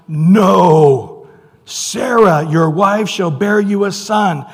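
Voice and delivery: loud and slow, declaring a promise; a commanding divine declaration; booming God voice. Acted. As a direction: proclaim it as God speaking. Preacher reads God's words aloud.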